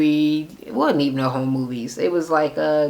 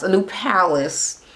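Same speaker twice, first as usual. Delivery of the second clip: ordinary delivery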